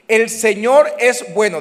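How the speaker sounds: slow, loud proclamation; preached declaration. Shown as a demonstration. Pastor proclaiming to the congregation, loud and slow. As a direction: call it out slowly for the crowd to repeat. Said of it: "diga conmigo".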